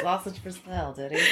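soft joke through laughter; giggling. Real, not acted. Co-host joking about the sausage salesman, giggling. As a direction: slip the joke in quietly, giggling.

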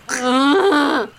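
long groan from a flattened character; face-down groan. Acted. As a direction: groan like you can't get up. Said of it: "still laying face down, just".

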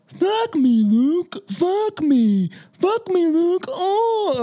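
mock deaf moaning. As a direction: ham up a deaf woman's moans. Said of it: "I just doing that to him".

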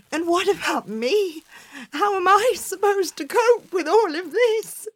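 high anxious willow voice; fretful willow. Acted. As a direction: complain in a put-upon wail. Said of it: "Willow sighed".

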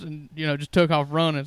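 normal talking voice

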